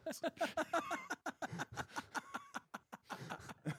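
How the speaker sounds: a long breathy laugh up high; high wheezing laugh. Real, not acted. Breaks into a high wheezy laugh at his own quote. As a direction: let a high, airless laugh run out.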